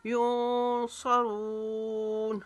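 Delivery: युनसरून very slow, nasal n held, रून stretched; ikhfa, long रून. Shown as a demonstration. Teacher demonstrating ikhfa, युनसरून with sustained nasal and long ending. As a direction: hum the n of युनसरून, then draw out रून. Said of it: "एक अलिफ के जितना इफ़ा करेंगे".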